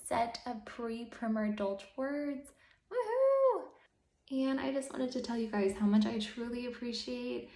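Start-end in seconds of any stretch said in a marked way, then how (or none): 2.9-3.6 high, loud woohoo; celebratory cheer. Real, not acted. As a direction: let out a drawn, high-pitched cheer. Cheers for last word set.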